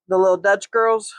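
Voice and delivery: ordinary talk, nothing marked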